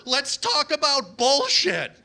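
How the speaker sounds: high, drawn-out yell ending in a fall; exasperated scream. Acted. Uber driver screaming a proposal at his passenger, exasperated. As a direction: yell it high and stretch the last word. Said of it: "I can scream pretty much whatever I want".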